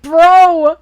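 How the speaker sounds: an exasperated yell at the clueless hero; exasperated shout. Retold or imitated. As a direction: throw up your hands with "Bro!". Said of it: "It's like".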